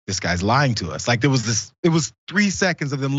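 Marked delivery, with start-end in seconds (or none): 0.1-1.1 low, slow grumble; 低沉慢速地嘀咕怀疑; suspicious grumble. Retold or imitated. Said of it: "they were like".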